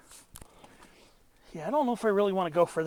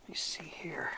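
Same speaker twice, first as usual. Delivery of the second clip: whispering; 9 dB quieter than usual. Real, not acted. Muttering while planning the descent.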